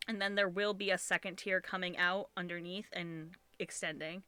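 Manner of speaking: usual speaking voice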